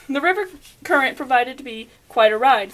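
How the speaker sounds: plain reading voice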